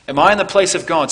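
ringing preacher's emphasis; emphatic repeat. Retold or imitated. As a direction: press it on them with force. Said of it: "notice how Joseph says".

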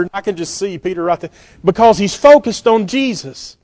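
ordinary delivery

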